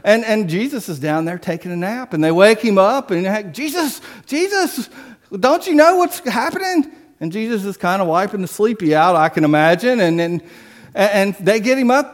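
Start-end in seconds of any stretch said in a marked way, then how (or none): 3.3-6.9 high pleading call, hammed-up; mock panic. Disciples waking Jesus in the storm, high mock panic. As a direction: shake him awake in mock alarm.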